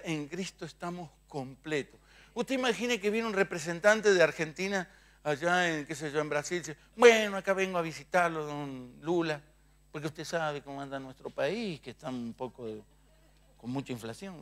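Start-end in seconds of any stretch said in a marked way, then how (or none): 0.0-2.0 quiet repeat-after-me. Shown as a demonstration. 7.0-14.4 a soft, meek envoy pleading with the president. Retold or imitated. Envoy asks don Lula for help, mock-meek and soft. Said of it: "¿Usted cree que habla así".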